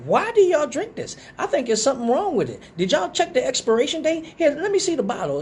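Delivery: high, whiny disbelief about the taste; incredulous complaint. Retold or imitated. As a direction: plead it like the drink must be spoiled. Complaining the drink tastes bad.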